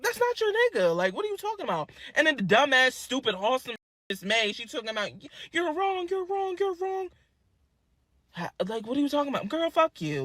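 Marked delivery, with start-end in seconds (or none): 0.0-1.9 high mock dismissive voice; imitating the boy. The boy defending her, high dismissive mock voice. 5.1-7.1 high nagging mock voice; mocking Miss May. Miss May scolding her, high nagging mock voice.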